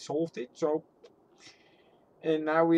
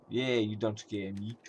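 ordinary talk

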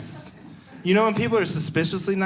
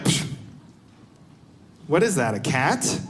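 feigned innocence; 4 semitones lower than usual. Pretend. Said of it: "I'm gonna bring it to these people like".

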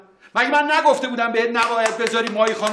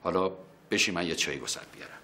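soft, low and gentle; calm him down kindly; reassuring; 12 semitones lower, 9 dB quieter than usual. Real, not acted. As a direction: uncle quietly promising the nephew his bride. Uncle reassures nephew gently.

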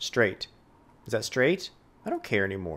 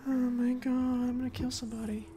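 mock murderous; 9 semitones higher, 6 dB quieter than usual. Real, not acted. Hyperbolic exasperation.

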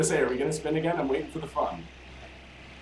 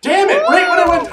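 loud frustrated yell, much higher; 11 dB louder than usual. Real, not acted. Dies in game, yells.

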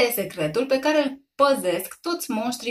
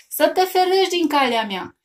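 higher, louder cry at the monster; shouted threat; 8 semitones higher, 5 dB louder than usual. A narrator's reading. Mother shouts at the monster, higher and louder. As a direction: yell to scare it off. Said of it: "strigă ea".

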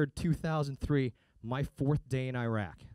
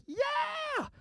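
much higher crowd yell; crowd cheer. Retold or imitated. Fans answering the band, mock cheer. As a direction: whoop like a concert crowd. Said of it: "we're like".

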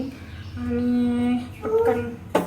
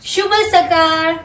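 शुभ सकाळ slow and higher, drawn out; slow demonstration; 4 semitones higher, 3 dB louder, much slower than usual. Shown as a demonstration. Mother showing her child how to say it, slow and higher. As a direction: model शुभ सकाळ slowly, lifting the pitch. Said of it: "mamma कसं म्हणते बघ".

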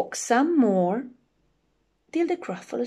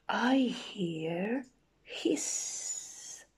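very slow, soft, with a long hiss; hushed listening; 7 dB quieter, much slower than usual. Acted. Gruffalo hears something in the leaves, slow hushed hiss. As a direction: stretch it out quietly as if listening.

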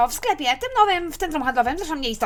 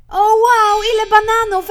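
thrilled; 5 semitones higher, 9 dB louder, slower than usual. Acted. Mother thrilled at bananas.

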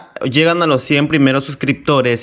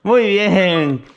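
talking to a dog; 5 semitones higher than usual. Real, not acted. Praising dog.

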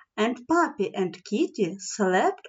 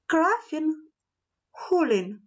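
usual speaking voice

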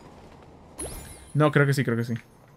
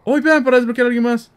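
much higher and louder, thrilled; gleeful gamer yelp at new unlock; excited discovery; 11 semitones higher, 9 dB louder than usual. Real, not acted. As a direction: shout the find to the viewers. Spots unlockable character.

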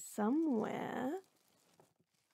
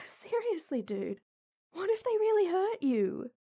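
worried outburst; 7 semitones higher than usual. Acted.

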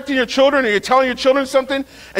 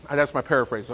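ordinary talk

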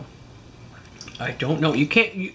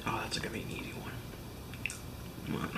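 whispered aside; 15 dB quieter, faster than usual. Real, not acted. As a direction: whisper it so she can't hear. Secret aside to camera.

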